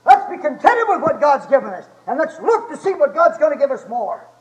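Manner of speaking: high, loud preaching at full force; fired-up preacher's shout; shouted exhortation. Real, not acted. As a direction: belt it out to the congregation, stretching the big words.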